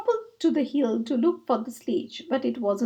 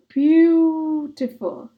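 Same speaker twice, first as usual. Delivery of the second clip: murmured; 3 semitones higher, 5 dB louder, much slower than usual. Acted. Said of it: "they murmured".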